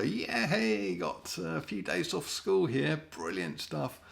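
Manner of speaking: lightly hammed-up pupil cheering a day off; mock school kid. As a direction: play a cheeky kid off school. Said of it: "there they were".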